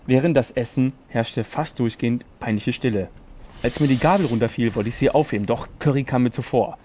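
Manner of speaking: low, hushed storyteller reading; serious bedtime-story narration. Acted. Reads fanfiction aloud.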